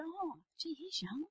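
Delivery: high, soft "oh, gee" of surprise; retold surprise. Speaker recalls remarking on Phil to Gary, mild surprise.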